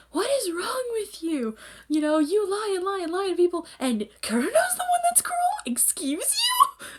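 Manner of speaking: very high, incredulous rant; hammed-up disbelief, ending stretched and squeaky; mock indignation. Haru grabs Akito, retold.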